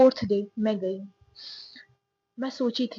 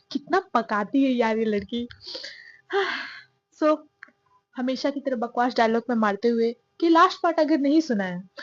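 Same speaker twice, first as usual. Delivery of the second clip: usual speaking voice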